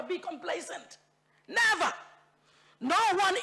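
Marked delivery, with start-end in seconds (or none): 1.5-1.9 Never punched high and loud; emphatic never. Real, not acted. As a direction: slam the word Never down. Emphatic single word in speech.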